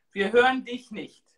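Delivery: ordinary delivery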